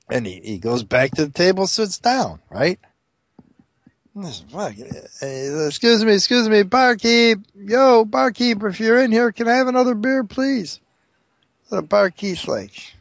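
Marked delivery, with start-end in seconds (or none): 5.7-10.8 raised-pitch call across a bar. Acted. Blind customer wanting another beer, calling out for service. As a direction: hail the barkeep from your seat.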